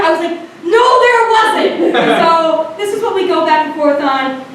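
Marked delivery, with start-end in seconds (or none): 0.6-1.9 high, drawn-out contradiction; exasperated denial. Retold or imitated. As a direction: deny it slowly and high, fed up. Retold argument, flat denial.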